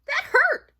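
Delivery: high, sharp storybook yelp; pained cry. Acted. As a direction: cry out as a mouse pricked by thorns. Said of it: "he cried".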